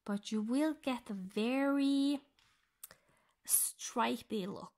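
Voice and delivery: ordinary delivery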